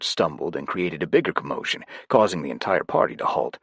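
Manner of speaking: ordinary delivery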